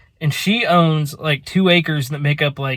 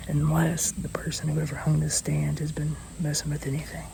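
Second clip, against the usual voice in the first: hushed hunting-stand whisper; 13 dB quieter than usual. Real, not acted. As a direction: keep it whispered so the deer don't hear.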